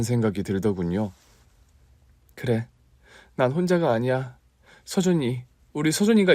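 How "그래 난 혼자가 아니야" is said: ordinary delivery, nothing marked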